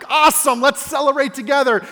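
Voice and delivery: loud, high, celebratory cheer; master's delighted response. Retold or imitated. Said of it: "He's like".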